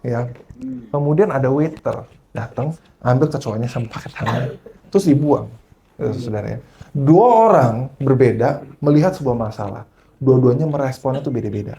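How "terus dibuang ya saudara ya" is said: ordinary talk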